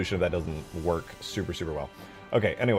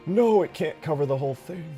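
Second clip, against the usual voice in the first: dismayed; 9 semitones higher, 5 dB louder than usual. Real, not acted. Dismay at a failed attempt.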